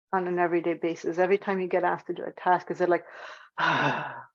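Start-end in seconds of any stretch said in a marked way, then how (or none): 3.6-4.3 low, reluctant exhale; weary sigh. Acted. Presenter acting out being asked to do a task, weary sigh. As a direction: sigh as if dreading the chore.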